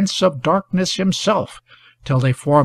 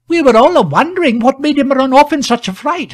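high, louder anxious woman's voice; faltering woman; 10 semitones higher, 6 dB louder than usual. A narrator's reading. Woman by the wall explains his flight, faltering. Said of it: "faltered one of the women".

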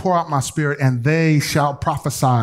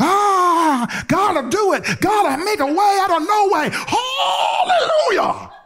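ecstatic praise; slower than usual. Retold or imitated. Believer touched by the Holy Ghost shouting praise, tearful and ecstatic. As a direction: cry out to God in tearful, rising joy, laughing at the end.

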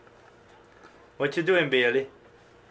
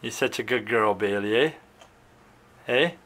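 cooing pet talk; 9 semitones lower, 5 dB quieter than usual. Real, not acted. Cooing praise to pet bird.

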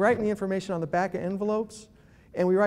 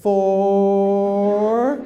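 FOUR stretched out long and loud; painfully slow; 9 dB louder than usual. Acted. Caller taking down the next digit, loud drawn-out word. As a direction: hold FOUR as if laboriously writing. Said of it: "you go like this".

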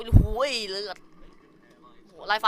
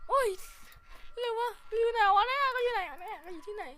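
panicked high voice; 14 semitones higher than usual. Real, not acted. Searching frantically after scare.

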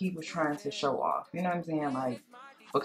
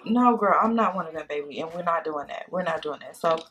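normal talking voice

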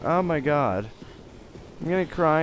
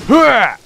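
high, loud effort cry; loud throwing yell; 11 semitones higher, 13 dB louder than usual. Pretend. Player hurling something in game, loud yell. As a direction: burst out with a heave.

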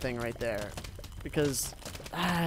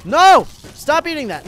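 sudden loud high "No!"; alarmed yell; 11 semitones higher, 14 dB louder than usual. Real, not acted. Gamer yelling at a zombie eating his pumpkin, loud high. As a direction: shout the zombie off the plant.

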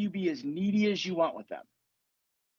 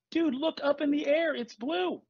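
higher-pitched, acted disbelief; incredulous; 7 semitones higher than usual. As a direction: point out the obvious, voice lifted. Acts out disbelief at denying the obvious.

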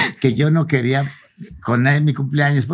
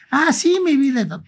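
lightly voiced sweet wife, much higher and slow; imitating wife's sweet reply; 11 semitones higher, slower than usual. Husband voicing his wife agreeing, sweet and high. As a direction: coo it like his doting wife.

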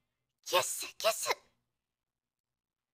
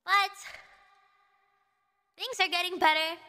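ordinary talk, nothing marked

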